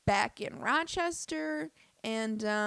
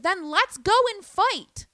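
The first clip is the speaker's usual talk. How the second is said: much higher and louder, slow, hammed-up dare; mock fight challenge; 10 semitones higher, 10 dB louder than usual. Retelling daring the woman to fight, a loud slow hammed-up dare. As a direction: bellow it like you are ready to throw down.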